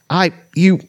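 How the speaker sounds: high, stuttering kender. Acted. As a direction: trip over the words. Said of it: "Taz stuttered".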